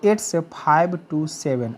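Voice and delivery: each word drawn out for learners; very slow model sentence. Shown as a demonstration. Teacher showing students how to say the time, very slow. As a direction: dictate it word by word, very slowly. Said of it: "तो इसका क्या हो जाएगा".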